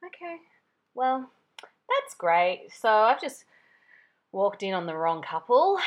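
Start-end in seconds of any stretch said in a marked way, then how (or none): 0.0-2.7 dry sarcasm. Retold or imitated. Wry reply after mix-up.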